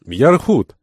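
high, loud call of a name; cried out. A narrator's reading. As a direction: exclaim it on sighting the captain. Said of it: "интендант вскричал".

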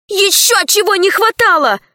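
high-pitched indignant outburst; indignant exclamation. A narrator's reading. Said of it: "воскликнула Алиса".

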